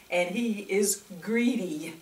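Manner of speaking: usual speaking voice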